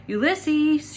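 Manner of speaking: high calling shout; shouted name. A narrator's reading. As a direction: call out into the dark. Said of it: "shouted Tootie".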